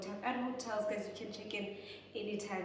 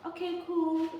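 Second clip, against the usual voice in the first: high, louder "Okay, cool"; sarcastic okay cool; 8 semitones higher, 6 dB louder than usual. Real, not acted. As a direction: ironic shrug in the voice. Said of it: "sarcastic aside".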